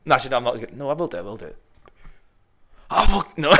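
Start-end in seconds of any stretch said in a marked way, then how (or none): 2.9-3.5 high, loud cry of refusal; embarrassed shout. Real, not acted. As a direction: yelp it out as you bail on the impression. Bottling out of the impression.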